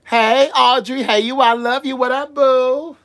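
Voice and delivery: high, loud gushing hello; excited greeting. Real, not acted. Streamer gushing hello to a viewer. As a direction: greet her like a long-lost friend.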